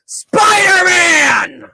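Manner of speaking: building to a big shouted Spider-Man; announcer hype. Acted. Host hyping the comic to viewers, big announcer shout.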